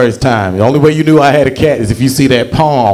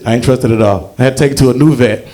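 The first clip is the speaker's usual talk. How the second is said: ordinary delivery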